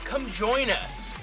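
normal talking voice

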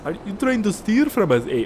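high-pitched, botched Canadian turning Scottish; accidentally Scottish accent. Retold or imitated. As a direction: attempt Canadian but land on a terrible Scottish accent. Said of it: "That was, like, Scottish".

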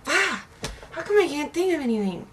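frustrated. Real, not acted. Stuck for an answer on camera.

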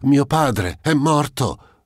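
high, slow, grief-stricken cry; anguished cry. A narrator's reading. Countess discovering her father dead, anguished cry. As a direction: wail the news out. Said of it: "gridò la contessa".

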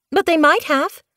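put-on high, insistent young kit voice. Young kit insisting to Moonflower, high and stubborn. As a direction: push back like a stubborn kitten. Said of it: "Snowkit insisted".

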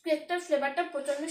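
chewing while talking; talking between bites; mouth full of cake. Real, not acted. Seen 0: Bite at -2.0; chewing still visible at start of speech.

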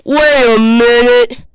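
slow, loud, stretched "Wait a minute"; loud realization. Pretend. Realizing the monster has gone, loud slow "Wait a minute". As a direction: boom "Wait a minute" slowly.

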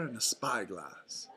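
ordinary delivery

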